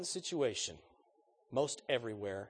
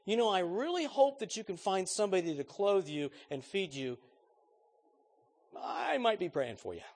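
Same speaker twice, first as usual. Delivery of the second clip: gentler, higher, hollow well-wishing; less harsh; 7 semitones higher, 5 dB louder than usual. Shown as a demonstration. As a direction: soften it but keep it empty. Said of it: "it's a little less harsh".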